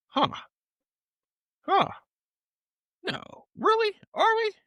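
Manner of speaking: very high, squeaked; high squeak of disbelief. Real, not acted. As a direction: pitch way up in disbelief. Surprise at episode count.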